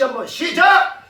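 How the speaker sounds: high, loud rallying call; shouted cue. Real, not acted. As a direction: rouse the congregation to shout. Cues congregation to shout again.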